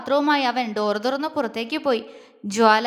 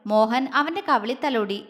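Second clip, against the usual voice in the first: usual speaking voice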